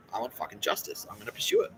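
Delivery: ordinary talk, nothing marked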